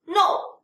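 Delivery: "No" starting high and loud, falling sharply; fourth tone. Shown as a demonstration. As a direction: drop "No" fast from a high pitch. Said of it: "this is how the fourth tone should pronounce".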